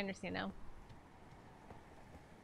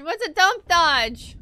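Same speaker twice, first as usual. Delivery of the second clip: baffled; 6 semitones higher, 8 dB louder, much slower than usual. Real, not acted. Baffled by game text.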